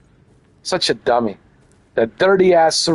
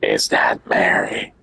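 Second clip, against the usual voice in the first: creepy whisper; slower than usual. Pretend.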